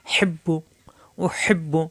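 teacher whispers the word উহিব্বু, unvoiced; whispered. Shown as a demonstration. Teacher to students, whispering উহিব্বু as a demo. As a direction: breathe উহিব্বু out with no voice. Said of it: "পরের বায়ে পেশ বু".

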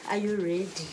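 ordinary delivery

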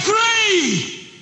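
very high, long angry shout; angry auctioneer. Acted. Angry auctioneer closing the sale, high angry shout. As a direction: cry out the final count. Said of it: "He was angry now".

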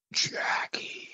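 breathy unvoiced ghost whisper of a name. Ghost on the recorder whispering its name to the man.

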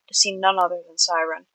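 usual speaking voice

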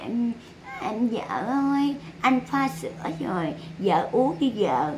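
soft, slow, syrupy husband voice; mock sweet husband. Husband offering milk to his wife, mock sweet cooing. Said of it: "Nói chuyện này".

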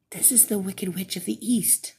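breathless whisper; a hushed warning whisper. A narrator's reading. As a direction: whisper it close and secret. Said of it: "Franzella whispered".

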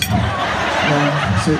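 ordinary talk

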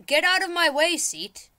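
high, loud barked order; exasperated yell. Pretend. Player orders the seat aside, exasperated yell. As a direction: shout it at an obstacle. Yells at obstacle.

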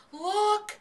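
high, loud scream of alarm. A narrator's reading. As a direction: scream it out to warn everyone. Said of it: "screamed Fern".

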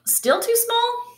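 squeaky high, disbelieving question; high incredulous still too small. Acted. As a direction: ask it in high-pitched disbelief. Refrain asked in disbelief.

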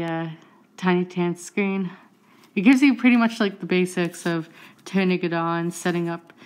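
ordinary talk, nothing marked

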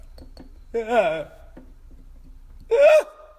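high, loud scared squeal; frightened yelp. Real, not acted. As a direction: yelp as the bug might move. Frightened while nudging the bug.

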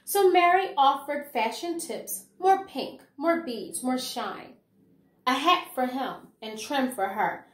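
lively, sing-song picture-book reading; reading to children. Acted. Teacher reading a rhyming picture book to her class, lively storytelling. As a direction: bring the rhymes alive for little listeners.

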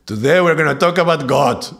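loud, high and bright declaration; excited teacher announcing a bold topic; bold announcement. Retold or imitated. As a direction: announce it proudly to the class. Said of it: "And there I went and I said".

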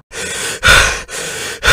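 big exasperated groan; loud anguished groan. Real, not acted. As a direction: groan loudly in despair. Loud groan after loss.

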